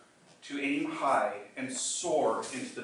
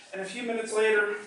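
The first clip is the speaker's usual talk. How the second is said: ordinary talk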